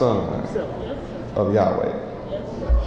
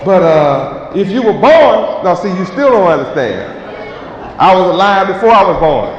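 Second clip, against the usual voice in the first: louder, proclaiming each line to the crowd; street preaching; 5 semitones higher, 3 dB louder than usual. Acted. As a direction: preach it out to passersby, building.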